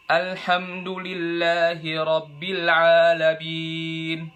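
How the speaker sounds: slow "Alhamdulillahi rabbil alamin" with the nose pinched halfway through; recite, nose pinched mid-line. Shown as a demonstration. Teacher tests nasal voice by pinching the nose mid-recitation. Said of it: "kalian pencet hidung kalian".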